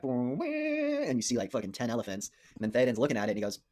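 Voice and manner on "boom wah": imitated elephant call. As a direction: mimic a war horn-like elephant bellow. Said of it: "then you hear that".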